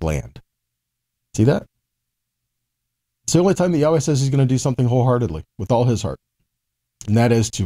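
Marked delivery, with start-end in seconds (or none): none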